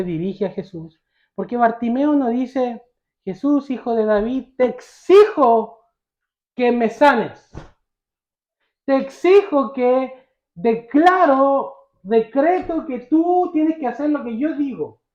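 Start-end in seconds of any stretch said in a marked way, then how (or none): 3.2-15.0 imitated demand, stretched and emphatic; mock demand. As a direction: play someone issuing decrees to Jesus, higher on the commands. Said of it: "Es una petición humilde". (exigencia imitada, enfática)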